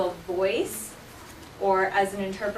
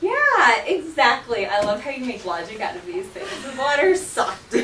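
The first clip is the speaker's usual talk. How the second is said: high, loud laughing praise; delighted laughing; 4 semitones higher, 7 dB louder than usual. Real, not acted. Praising a student's logic, laughing. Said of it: "when things get wet".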